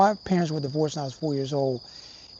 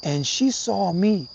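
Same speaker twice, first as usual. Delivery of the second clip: normal talking voice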